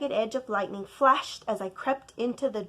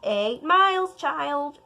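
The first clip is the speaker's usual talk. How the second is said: 1 croaking grandma; 5 semitones higher, 8 dB louder, slower than usual. Acted. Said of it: "Grandma croaked".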